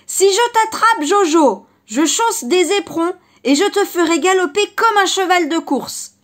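loud, high threatening shout; fox shouting. Acted. The fox yells a threat after the rabbit from afar. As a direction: yell the threat from far off. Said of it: "il cria de loin".

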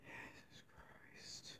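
breathy, drawn-out whispered curse; a real groaning whisper of disbelief; exasperated whisper. Real, not acted. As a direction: whisper it slowly under your breath. Frustrated gamer alone at console.

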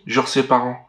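ordinary delivery

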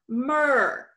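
"mer" on its own, with a held er vowel; syllable demonstration. Shown as a demonstration. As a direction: sound out "mer" as a separate syllable. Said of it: "is an er sound".

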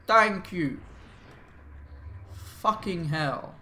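usual speaking voice

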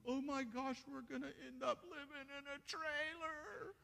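very high, hammed-up squeal of worry; mock panic. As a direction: play the anxious spouse spiraling. Mock anxious spouse panicking again.

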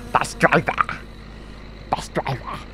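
softly whispered call. Pretend. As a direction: breathe it without voice. Whispering to the driver.

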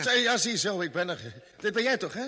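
triumphant shout at finally arriving; excited relief. Acted.

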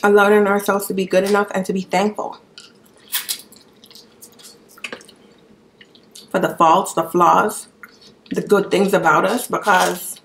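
with food in mouth; chewing between words; talking while eating. Real, not acted. Seen 1: Picks crab meat throughout; bites crab at mouth around 2.7-4.4s.

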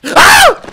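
scream. Real, not acted. Jump scare in horror game.

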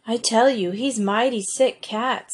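plain reading voice

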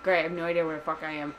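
usual speaking voice

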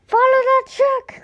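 very high, loud urgent cry. Acted. Boy urging his dad to chase the truck, loud high cry. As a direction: yell it, point at the truck. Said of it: "cried Buzz".

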